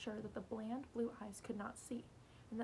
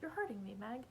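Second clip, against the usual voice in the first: ordinary delivery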